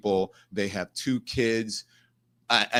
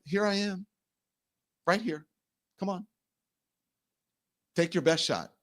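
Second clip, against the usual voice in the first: softer, higher retold dare; calm challenge; 7 semitones higher, 5 dB quieter than usual. Daring the man to hit him, quiet challenge. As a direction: drop the volume and dare him, short phrases.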